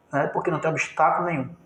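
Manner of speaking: usual speaking voice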